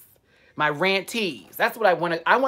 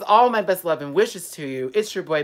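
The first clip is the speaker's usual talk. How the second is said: ordinary talk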